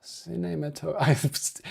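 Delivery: Cinematograph slow, ending in oi; trying French pronunciation. Shown as a demonstration. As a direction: Cinematograph drawn out, French style. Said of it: "coach me on how to say".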